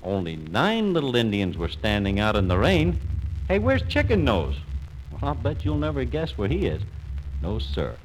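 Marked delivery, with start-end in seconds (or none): 3.5-4.5 high, puzzled call; boy calling out. Acted. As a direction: call out for a missing friend. Boys notice he is missing.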